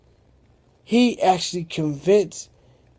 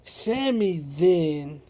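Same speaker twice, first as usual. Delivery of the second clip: usual speaking voice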